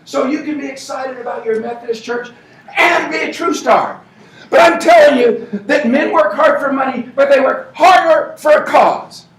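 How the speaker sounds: loud, high, driving pep-rally preaching; rousing rally speech. Acted. Team leader firing up the crowd about the Heat, shouting. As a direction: fire up the crowd at full volume.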